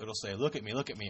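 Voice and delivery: ordinary talk, nothing marked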